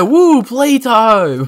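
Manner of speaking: high, loud, long-drawn whoop; mock excited cheer. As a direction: imitate a stretched-out playtime cheer. Quoted cheer as playtime starts.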